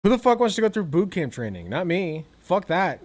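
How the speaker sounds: ordinary talk, nothing marked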